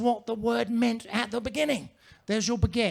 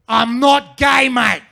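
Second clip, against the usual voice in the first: slow, loud and higher, a blokey denial; loud defensive bloke; 5 semitones higher, 10 dB louder, much slower than usual. Retold or imitated. An offended Australian bloke shouting back at the comedian. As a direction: drag it out like a defensive lad.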